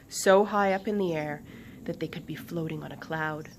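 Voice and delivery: ordinary delivery, nothing marked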